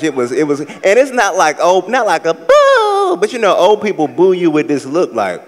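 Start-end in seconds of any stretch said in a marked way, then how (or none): 1.9-3.2 a high, slow mock boo; imitating a silent old-folks boo.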